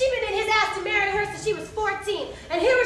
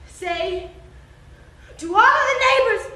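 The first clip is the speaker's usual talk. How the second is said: building to a high, loud command; shouted demand; 4 semitones higher, 5 dB louder than usual. Acted. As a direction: start contained, then bellow the order. Man demands she tell neighbors.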